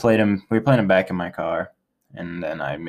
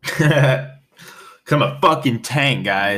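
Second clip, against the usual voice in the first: slow laughing boast; 4 semitones higher, much slower than usual. Real, not acted. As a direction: laugh through the brag after a shot. Laughing boast after a shot.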